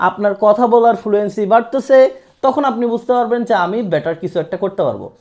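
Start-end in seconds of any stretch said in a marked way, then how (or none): none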